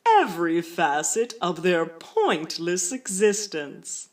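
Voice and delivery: very slow, ceremonious, drawn-out delivery; otherworldly official's letter. Acted. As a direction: intone each word like a solemn proclamation. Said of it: "including several different voices".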